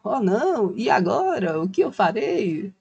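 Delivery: lightly hammed-up despair; a mild, drawn-out mock lament; mock panic. Creator imitates an overemotional investor unsure what to do, mock dismay. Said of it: "você não ficar emocionado".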